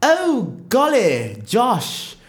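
a rich couple out for a stroll, very proper; posh rich stroller. Pretend. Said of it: "then they'll be walking like this".

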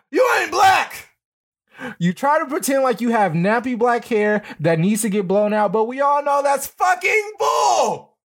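mock Guapo yelling furiously; imitating Guapo's mad shout at her. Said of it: "Guapo got mad".

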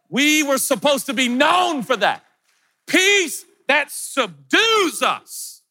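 high and slow, stretching each word; very slow, high preaching lift; soaring preach. Acted. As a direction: lift it up like a sermon peak.

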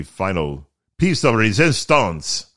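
slow, hammed-up French accent; terrible French accent. Podcaster showing off the final renovation touch, terrible French accent. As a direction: put on a bad French accent. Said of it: "my terrible French accent".

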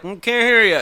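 high, loud, dismissive mumble; imitating drunk man. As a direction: brush her off like a drunk.

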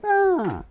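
high, falling muffled moan; muffled cry. Acted. Game master voicing the gagged professor, muffled cry. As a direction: imitate a gagged cry for help. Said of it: "muffled, like".

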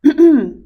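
sharp, loud clearing of the throat. Real, not acted. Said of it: "I got a little frog in my throat".

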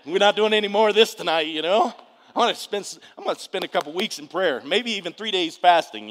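loud, high-pitched flustered protest; emphatic refusal. Acted. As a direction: act out being completely done with it. Acts out his refusal while fleeing.

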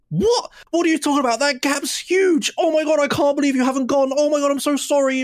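high-pitched imitation of an exasperated instructor; mock scolding. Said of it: "when scolding me".

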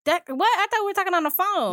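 high-pitched confused objection; surprised protest. Real, not acted. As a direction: blurt it out, caught off guard. Protesting the changed rules.

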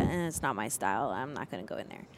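low, offhand reluctance; dismissive. Retold or imitated. Talking herself out of going into the store, dismissive. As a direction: shrug it off, dropping low and quiet.

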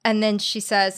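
normal talking voice

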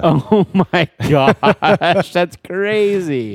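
slow, high laughing amazement; laughing disbelief. Real, not acted. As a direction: laugh through the realization. Sudden revelation about the barista.

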